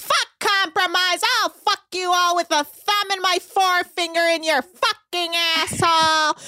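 hammed-up defiant rant; mock furious tirade. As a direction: imitate an outraged refusal.

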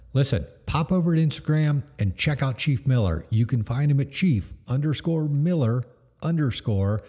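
ad read. Acted. Sponsor read.

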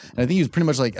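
ordinary talk, nothing marked

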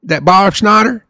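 hammed-up Southern country twang; country accent. Shown as a demonstration. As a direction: play a country boy gossiping in thick Southern dialect. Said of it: "I could talk country all day long".

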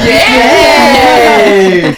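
loud high cheer. Real, not acted. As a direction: whoop for the guests. Cheering guest arrival.

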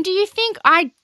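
high, loud mock complaint; indignant and pitched right up; own high voice, exaggerated. Shown as a demonstration. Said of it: "I don't sound like this".

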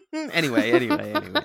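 high, laughing attempt to move on. Real, not acted. Host moving on after a silly impression, real laughter. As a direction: giggle through it, pitch climbing.

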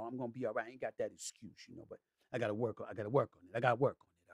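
ordinary talk

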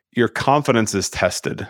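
usual speaking voice

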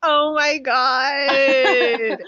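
long, loud, high wail; overcome swooning. Real, not acted. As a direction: stretch it out in tearful rapture. Overcome by the retold romantic moment.

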